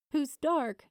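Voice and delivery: ordinary delivery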